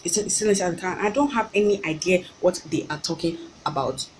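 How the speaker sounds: normal talking voice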